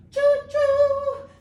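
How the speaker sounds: high, loud choo choo whistle; train whistle. Shown as a demonstration. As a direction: toot it like a steam train. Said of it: "like a train".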